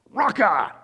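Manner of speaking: playful outburst at a driver who cut you off; mock road rage. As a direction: spit it out like an insult to a bad driver. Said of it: "someone cuts you off in traffic".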